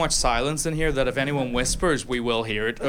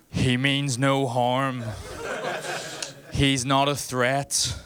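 slow reassuring announcement; 3 semitones higher, much slower than usual. Retold or imitated. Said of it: "he goes to the rest of the gym".

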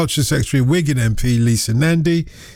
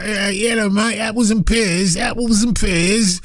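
higher, hammed-up Cockney; mock Cockney accent; 4 semitones higher, slower than usual. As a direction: send up a fake Cockney, dragging the repeat. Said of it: "they don't speak like".

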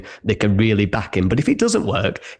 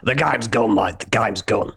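Ange Postecoglou impression. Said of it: "or as Ange Postecoglou would say".

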